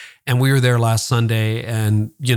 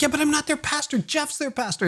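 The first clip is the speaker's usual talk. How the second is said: much higher, softer, whiny protest; mock whiny protest; 6 dB quieter than usual. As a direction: protest like a sulky kid, pitched way up. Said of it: "I'm like".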